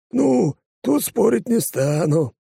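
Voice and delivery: perking up, half amused; cheered up. Acted. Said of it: "повеселел Фесков". (повеселевший Фесков)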